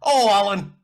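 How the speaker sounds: put-on loud, high English soap accent, Coronation Street style. Mimicking actresses at the audition, loud Coronation Street accent. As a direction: ham up a Coronation Street accent calling Alan. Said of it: "they had, like, Coronation Street accents".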